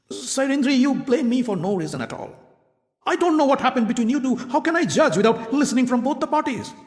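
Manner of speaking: lightly voiced nervous excuse; a hint of a king squirming; defensive king. A narrator's reading. King evades blame.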